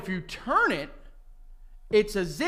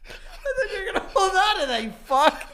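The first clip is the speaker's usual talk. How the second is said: laughing apology; faster than usual. Real, not acted.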